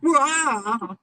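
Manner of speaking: high stumbling yelp; mock yelp. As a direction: squeal as if tripping in wedges. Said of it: "And she's like".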